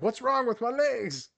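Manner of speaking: mock a scared athlete whose legs won't work; panicked athlete. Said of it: "fifty percent of them are just, like, shaking".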